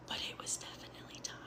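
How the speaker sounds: very soft, quick whisper; hushed, rapid admission under the breath; whispered aside. Real, not acted. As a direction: whisper it as a confession on the side. Hushed aside admitting the truth.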